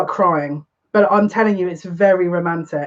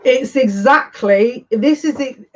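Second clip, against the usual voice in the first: higher and louder, slow and amazed; excited recognition; 5 semitones higher, 3 dB louder, slower than usual. Real, not acted. Reader to her viewers, seeing her vision confirmed: excited. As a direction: gasp it out as the card confirms it.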